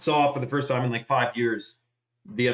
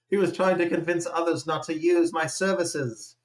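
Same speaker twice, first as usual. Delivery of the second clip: mock British accent, pitched higher; 5 semitones higher than usual. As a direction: put on a British accent as an offended AI. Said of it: "I do a British accent for that".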